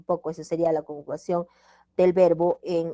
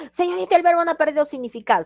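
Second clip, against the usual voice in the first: high, loud, fast pupil objection; acted student protest; 10 semitones higher, 6 dB louder, faster than usual. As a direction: object as a student, pitched way up and rushing. Voices student objecting to the teacher.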